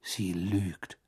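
low, soft, drained voice; weary. A narrator's reading. Ron watches her leave in disbelief, wearily. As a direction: say it faintly, all fight gone. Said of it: "sagte er matt".

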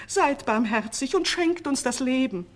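pleading. A narrator's reading. Two old sorcerers begging for life, pleading. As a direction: implore him to spare you. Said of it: "flehten die beiden Alten".

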